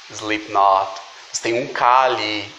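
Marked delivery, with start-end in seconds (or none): 0.1-0.9 Slipknot with no K and no vowel after the final T; silent K. Shown as a demonstration. Teacher models the clean English ending, clearly. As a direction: drop the K in Slipknot. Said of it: "esse K ele não é pronunciado".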